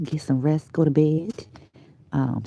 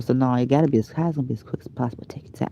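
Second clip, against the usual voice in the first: talking fast; 4 semitones lower, much faster than usual. Real, not acted. Hurrying viewers to send letters, really fast talking. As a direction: race through it, trailing under your breath. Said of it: "I'm really talking fast".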